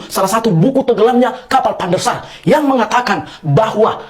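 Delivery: stubborn shouting match; loud family squabble. Acted. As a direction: yell every point like you must win. Family shouting match, egg or chicken.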